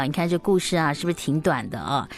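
usual speaking voice

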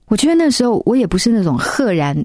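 usual speaking voice

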